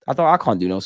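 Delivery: ordinary delivery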